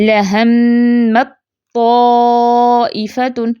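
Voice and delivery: slow Quranic recitation with full idgham of ت into ط; tajweed recitation. Shown as a demonstration. Teacher demonstrates idgham to students, slow chanted recitation. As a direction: recite لَهَمَّتْ طَائِفَةٌ slowly, ت merged into ط. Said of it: "پہلے تا پھر تو نہیں پڑھتے ہم".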